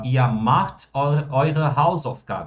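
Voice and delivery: very slow, every German word laid out clearly; slow model sentence. Shown as a demonstration. Teacher modelling German for students, very slow and clear. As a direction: spell the sentence out slowly for the class. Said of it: "nossa afirmativa".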